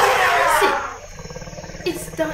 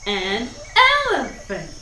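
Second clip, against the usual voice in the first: ordinary delivery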